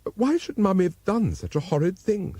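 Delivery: put-on a touch of a plaintive young woman; Jessica's voice. A narrator's reading. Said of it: "she asked".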